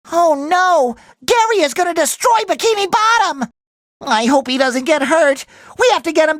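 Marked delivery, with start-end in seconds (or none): 0.1-3.5 high-pitched panicked exclamation; alarmed. Acted. Warning that Gary will destroy Bikini Bottom, alarmed. Sees pet endangering the town.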